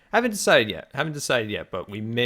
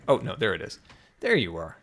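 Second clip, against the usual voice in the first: normal talking voice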